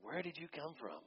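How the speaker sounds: soft, almost whispered question; hushed question. Acted. Pilate quietly asking Jesus where he comes from, hushed. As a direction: ask it gently, under the breath. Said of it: "said to Jesus".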